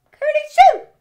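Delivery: a big squeaky sneeze, high and loud. Acted. Peter sneezing while hiding in the shed. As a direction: burst out with a rabbit's sneeze.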